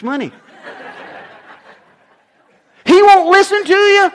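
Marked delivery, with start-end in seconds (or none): 2.8-4.1 complaining wife. Acted.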